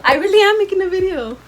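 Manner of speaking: loud, high-pitched yell back; insistent shout. Real, not acted. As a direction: holler it back, insisting over their doubt. Insists loudly to doubting off-camera person.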